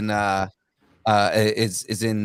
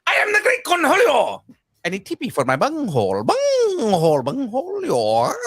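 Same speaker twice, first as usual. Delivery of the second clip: Cornholio voice; 8 semitones higher than usual. Acted. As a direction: rant like a crazed cartoon teen. Cornholio impression.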